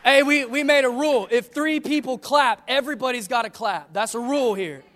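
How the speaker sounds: rallying preacher. Real, not acted. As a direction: playfully lay down the rule to the congregation. Rallies the congregation about clapping.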